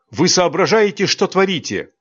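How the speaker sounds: high, indignant scolding; angry rebuke. Acted. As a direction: demand an answer, outraged. (возмущённый упрёк)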